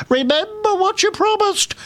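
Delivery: very high, straining creature call; frog calling out. A narrator's reading. Frog reminds the fleeing princess, calling out. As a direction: cry out after someone as a frog. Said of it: "called the frog after her".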